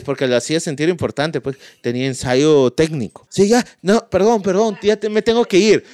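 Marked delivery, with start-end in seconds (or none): 3.3-5.8 Brian hurrying away. Retold or imitated.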